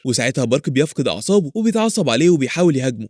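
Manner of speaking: ordinary delivery, nothing marked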